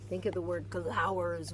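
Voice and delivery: lively, expressive picture-book read-aloud; animated storybook narration; reading to children. Acted. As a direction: read it like storytime for a little one.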